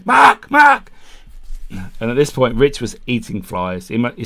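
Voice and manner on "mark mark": high, loud shout across the campsite; calling out. Retold or imitated. Calling Mark to pack up and go, shouting. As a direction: yell for him to hurry.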